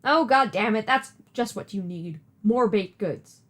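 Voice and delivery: usual speaking voice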